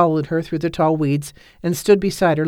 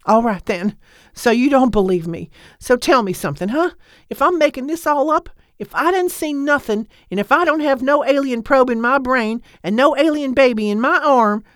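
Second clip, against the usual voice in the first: loud and heated; 9 semitones higher than usual. A narrator's reading. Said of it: "her voice was loud enough to carry".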